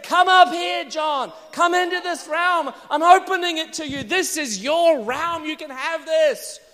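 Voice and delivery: the heavenly voice. Acted. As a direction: call John up like a voice from heaven. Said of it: "The voice said".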